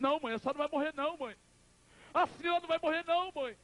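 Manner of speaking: high, rushed wail to a mother; acted desperate plea. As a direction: beg her in a high cry, words tumbling out. Son begs dying mother to stay.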